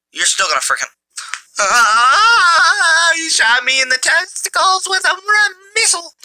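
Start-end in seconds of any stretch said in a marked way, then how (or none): 1.6-6.1 outraged yell. Real, not acted. Gamer shot by an enemy missile, yelling in outrage. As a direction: protest in shocked outrage. Outraged after being hit.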